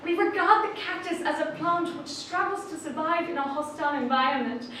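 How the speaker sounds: normal talking voice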